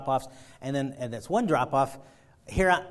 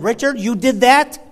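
loud, higher disbelieving question; incredulous boss; 8 semitones higher, 15 dB louder than usual. Retold or imitated. Boss sees dent.

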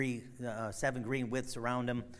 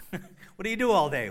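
lightly voiced, louder asking; mock questioner; 7 semitones higher, 9 dB louder than usual. Preacher jokingly questioning a liar in wait. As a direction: tease it like a job interview.

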